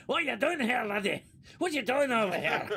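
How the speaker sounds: hammed-up Scottish brogue; mock Scottish accent. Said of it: "so stereotypically Scottish".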